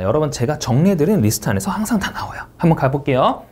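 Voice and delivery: normal talking voice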